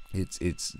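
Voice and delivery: ordinary delivery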